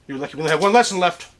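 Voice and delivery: normal talking voice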